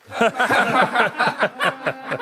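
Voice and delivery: big teasing laugh; hearty laugh. Acted. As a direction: burst of loud laughter. Soldiers teasing about rat.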